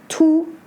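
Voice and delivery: "Tu" held high and level; first tone. Shown as a demonstration. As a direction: keep "Tu" on one steady high pitch. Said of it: "but have different tones".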